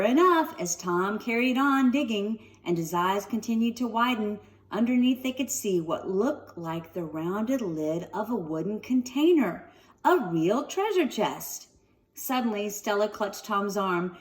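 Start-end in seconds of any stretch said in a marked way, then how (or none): none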